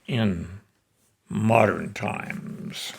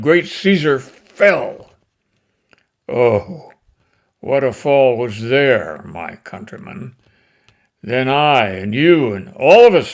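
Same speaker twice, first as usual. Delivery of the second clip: declaiming Shakespeare, swelling to loud peaks; dramatic recitation; 3 semitones higher, 7 dB louder than usual. Acted. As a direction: deliver Antony's speech to the crowd with rising passion. Recites Antony's funeral speech.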